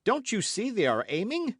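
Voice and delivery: urgent alarm. A narrator's reading. Warning under gunfire.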